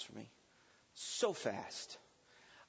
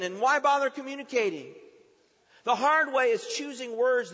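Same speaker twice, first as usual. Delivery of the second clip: raised, loud sermon climax; forceful preaching; 8 semitones higher, 10 dB louder than usual. Real, not acted. Preacher warning against the hard way, forceful and loud. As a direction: preach it hard, pounding out each charge.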